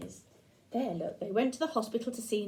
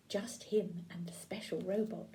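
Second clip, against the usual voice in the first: softer, whispered aside; 3 semitones lower, 10 dB quieter than usual. Acted. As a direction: whisper it like sneaking in. Sneaking into Nan's room.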